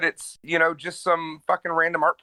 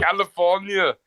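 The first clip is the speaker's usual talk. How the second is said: Schwarzenegger California; 4 semitones higher than usual. Acted. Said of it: "Arnold Schwarzenegger saying California".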